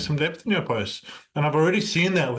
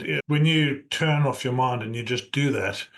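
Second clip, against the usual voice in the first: ordinary talk, nothing marked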